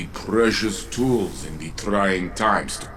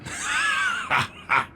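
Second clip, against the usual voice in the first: short hearty laugh. Acted. Saint-14 laughs mid-greeting.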